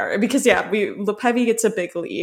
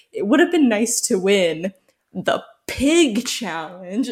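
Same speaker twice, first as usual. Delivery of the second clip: slow, drawn-out mock confessional line; imitating odd emphasis; slower than usual. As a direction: stretch "the pig challenge" out slowly, mocking her emphasis. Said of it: "with that emphasis".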